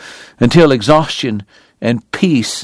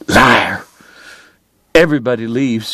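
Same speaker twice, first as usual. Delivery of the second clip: understated bitterness; bitter muttering. A narrator's reading. Crying woman accusing her lover, mildly bitter mutter. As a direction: grumble it with a touch of bitterness. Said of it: "she muttered bitterly".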